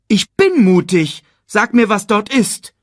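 high, loud insistent cub; determined. A narrator's reading. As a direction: demand an answer bravely. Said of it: "sagte Simba bestimmt".

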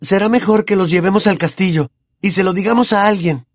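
put-on high, urgent boy's voice; Harry's voice. A narrator's reading. Harry urging Hermione to get help, high and hurried. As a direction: talk it through in a hurry. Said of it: "dijo Harry".

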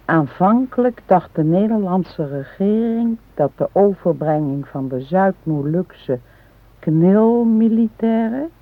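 careful, deliberate reading from a book; reading aloud. Acted. Wife reads a history book aloud to her husband. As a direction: read the passage out to her husband.

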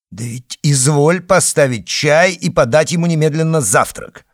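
loud, high, angry order. Acted. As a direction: demand it sharply. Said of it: "сердитый приказ".

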